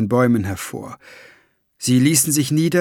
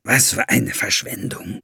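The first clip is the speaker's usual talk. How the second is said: grumbling; 4 semitones lower than usual. A narrator's reading. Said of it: "brummte er".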